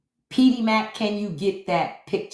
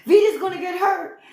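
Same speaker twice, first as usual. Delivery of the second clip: much higher, fretful falsetto; imitating a worried lady; 11 semitones higher than usual. Townswoman warning the ladies Vida will get hurt, high fret. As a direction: squeal it like a panicked townswoman. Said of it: "Stalker was trying to tell them".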